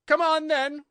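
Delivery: loud, high queenly bellow; roared. A narrator's reading. Queen summoning Alice to play, high roar. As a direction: roar the order. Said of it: "roared the Queen".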